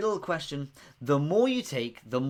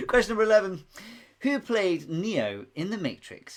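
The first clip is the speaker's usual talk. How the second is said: ordinary talk, nothing marked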